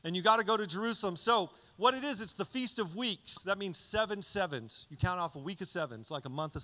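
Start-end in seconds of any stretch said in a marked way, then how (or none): none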